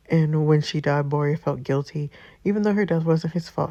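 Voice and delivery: ordinary talk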